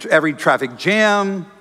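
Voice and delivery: ordinary talk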